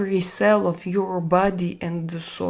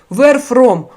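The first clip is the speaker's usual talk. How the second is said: curt, much louder, higher question; rude demand; 4 semitones higher, 11 dB louder than usual. Retold or imitated. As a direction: throw it out bluntly like a rude viewer. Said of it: "it sounds, like, super rude".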